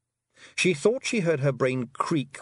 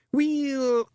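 drawn-out hesitant Scots weel; stall, caught out; shifty Rob. A narrator's reading. Rob caught out by Tiffany, shifty Scots hesitation. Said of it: "Rob looked a little shifty".

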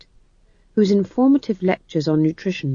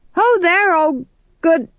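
higher, louder hail that falters; tentative hail; 12 semitones higher, 5 dB louder than usual. A narrator's reading. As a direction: call out, then falter mid-greeting. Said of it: "he hazarded".

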